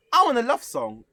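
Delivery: high, loud imitation of a panelist; imitated loud demand. Panelist asking pianist for another choice, mock loud demand. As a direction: call it out as a demanding auditioner. Voicing Miss Lane's blunt demand.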